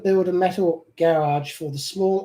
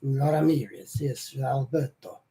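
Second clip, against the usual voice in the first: suave Latin lover; 6 semitones lower, 6 dB quieter, slower than usual. Pretend. Savouring the name Alberto Ramirez.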